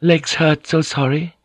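high, apologetic Japanese woman; thin, high voice. A narrator's reading. Fujiko admits her legs still hurt, thin high voice. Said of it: "in her thin, high voice".

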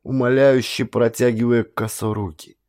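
plain reading voice

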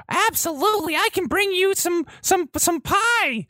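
put-on very high, eager little boy; gee-whiz child voice; wholesome 1950s kid.

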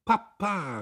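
high, loud call, falling away. A narrator's reading. Girl calls to her father in defiance, calling out. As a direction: call for your father at once. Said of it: "rief Käthchen sofort".

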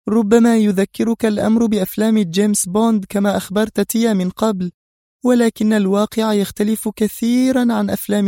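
high-pitched woman boss, teasing; playful villainess. A narrator's reading. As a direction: toy with your captive, amused. Villainess voiced by narrator. (زعيمة عابثة بصوت عالي الطبقة)

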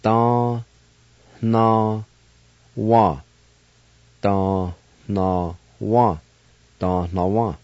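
Da, na, wa said as separate paced syllables; teacher drilling syllables. Shown as a demonstration. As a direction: sound out Da, na, wa one by one. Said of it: "And now pronounce these words".

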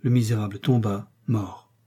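ordinary delivery, nothing marked

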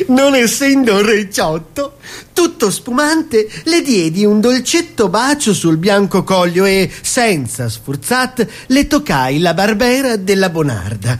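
high, slow, theatrical verse; hammed-up recital. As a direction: overplay the romantic climax. Reading a comic wine poem. (recita enfatica, acuta e lenta)

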